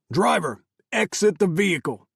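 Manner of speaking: high, loud barked command; barked order. A narrator's reading. Soldier ordering the driver out, loud bark. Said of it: "Carl barked".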